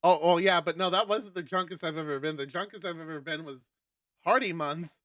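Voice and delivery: ordinary talk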